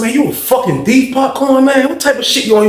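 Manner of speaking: high-pitched, indignant confrontation; accusing. Retold or imitated. Inmate calling Popcorn a thief, high-pitched and accusing.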